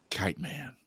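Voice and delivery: unvoiced whispered reveal. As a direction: breathe the name like a secret. Whispered reveal in retold pitch.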